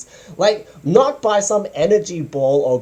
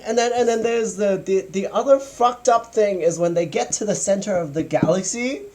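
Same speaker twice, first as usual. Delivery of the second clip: normal talking voice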